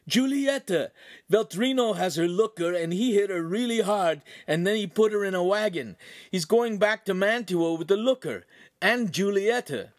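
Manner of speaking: high-pitched urgent account; frantic explaining. A narrator's reading. Wounded Ugolino reporting the abduction, high frantic voice. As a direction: insist on each fact in panic. Frantic man repeats his news.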